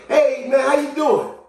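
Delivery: high, loud upbeat hello; hearty greeting. Acted. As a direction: call out to an acquaintance. Acting out greeting someone seen.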